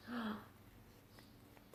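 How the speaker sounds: soft surprised gasp; mock gasp. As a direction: gasp for the kids. Teacher gasps at picture.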